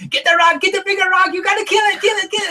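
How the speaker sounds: high, urgent mock yell; retold frantic yelling. Castaway yelling during eel hunt, frantic high shout. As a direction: yell at him to kill the eel. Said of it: "he's yelling at me".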